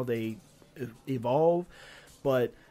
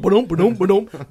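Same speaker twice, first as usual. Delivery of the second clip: louder rhythmic ba-dum bumping; imitated camera bump; 5 semitones higher, 11 dB louder than usual. Griping about the game camera, loud mock bumping. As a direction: mimic a jolting camera. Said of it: "It's going".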